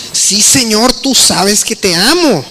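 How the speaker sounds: a touch of wounded impatience; irritated Peter. Retold or imitated. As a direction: answer like a man tired of being asked. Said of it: "ya me imagino el tono".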